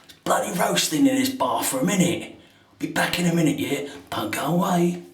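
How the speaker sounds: put-on light London accent, cheeky; a hint of a chirpy London voice. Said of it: "I've got my London accent on".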